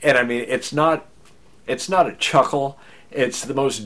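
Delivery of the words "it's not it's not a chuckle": ordinary delivery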